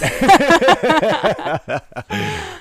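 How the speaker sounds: shrieking laugh at her own joke; loud high burst of laughter. Real, not acted. Friend cracks up at her own cocaine joke, loud shrieking laugh. Joking about bagsy of cocaine.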